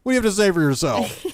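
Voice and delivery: fly off the handle in jest; rapid-fire mock interrogation; mock aggressive. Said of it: "You got too aggressive".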